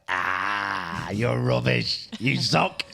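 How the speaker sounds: slow, teasing jeer; mock banter insult. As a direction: rib a mate playfully. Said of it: "it's like".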